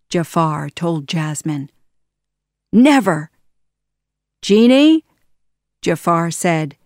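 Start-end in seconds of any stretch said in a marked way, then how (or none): 2.7-3.3 defiant refusal. Acted. Jasmine refuses Jafar. 4.4-5.0 commanding call. Acted. Jafar summons the genie.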